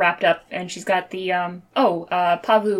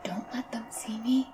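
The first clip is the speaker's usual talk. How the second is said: hushed secret whisper; 4 semitones higher, 11 dB quieter than usual. Acted. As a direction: whisper it urgently. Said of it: "in your head you hear".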